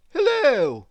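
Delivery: high, loud call of hello; Mister Clever calling out a loud hello; cried out. Acted. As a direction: call out to a stranger. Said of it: "cried Mister Clever".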